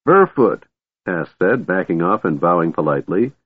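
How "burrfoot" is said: high, bright, polite kender. A narrator's reading. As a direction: introduce yourself with a bow. Tass introducing himself.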